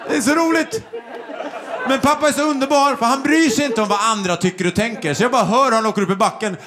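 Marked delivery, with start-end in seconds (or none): none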